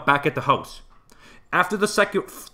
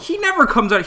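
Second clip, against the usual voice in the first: indignant outburst; 11 semitones higher, 5 dB louder than usual. Real, not acted. Heated argument about Ron.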